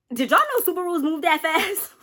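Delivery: high-pitched comic disbelief; mock astonishment. Asking y'all about the car chasing her, high mock astonishment. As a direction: ask it way up high, amazed at the speed.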